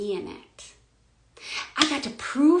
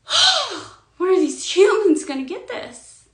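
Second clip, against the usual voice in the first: much higher, louder exasperated sigh; exasperated Source; 9 semitones higher, 9 dB louder than usual. Retold or imitated. Voicing Source, fed up with humans, in a high exasperated voice. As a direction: sigh it out like a fed-up cosmic parent. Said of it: "Source is like".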